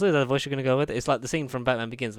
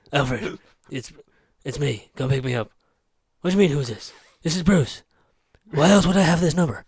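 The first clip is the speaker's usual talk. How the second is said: Batman voice on phone; 7 dB louder than usual. Retold or imitated. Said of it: "the Bale voice".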